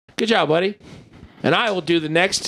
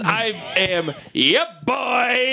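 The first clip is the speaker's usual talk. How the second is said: slow drawn-out host intro; announcer voice; 6 semitones higher, much slower than usual. Acted. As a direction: announce the name slowly. Podcast host self-introduction.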